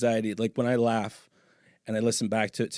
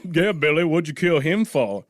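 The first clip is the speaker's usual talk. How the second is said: acted movie questioner; 4 semitones higher, 5 dB louder than usual. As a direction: press Billy for an answer in mock-western style. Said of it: "He's like".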